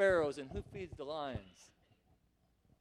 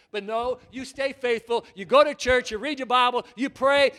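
ordinary delivery

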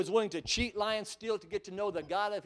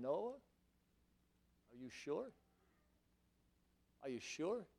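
doubting family; 4 semitones lower, 13 dB quieter, much slower than usual. Acted. Wife and kids doubting Noah.

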